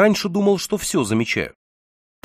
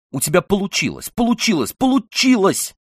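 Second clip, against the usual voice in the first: rapid, rising excited chatter; 6 semitones higher than usual. A narrator's reading. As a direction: gush it out, pitch climbing. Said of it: "Затараторила она мне в ухо".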